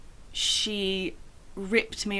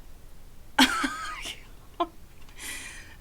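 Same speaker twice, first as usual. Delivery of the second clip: incredulous laugh. Real, not acted. Laughing at teacher's question.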